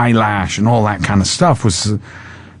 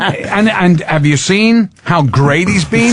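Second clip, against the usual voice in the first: high excited defense; 8 semitones higher, slower than usual. Retold or imitated. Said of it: "I went".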